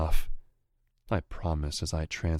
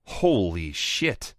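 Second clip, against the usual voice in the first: slow stunned exclamation; exclaiming; 3 semitones higher, 5 dB louder, slower than usual. Acted. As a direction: blurt out in disbelief. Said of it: "Artyom exclaims".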